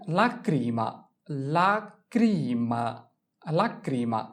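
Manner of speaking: লাক্রিমা drawn out, la-KRI-ma; slow model লাক্রিমা; slow teaching pronunciation. Shown as a demonstration. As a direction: stretch লাক্রিমা slowly for learners. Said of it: "Italian ভাষায়".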